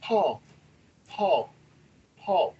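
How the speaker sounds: soft, spaced-out name calling; ghostly woman's voice. Retold or imitated. Woman's voice on video calling the sleeper, soft. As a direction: imitate a spirit calling softly, pausing between calls. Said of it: "you can hear a woman's voice go".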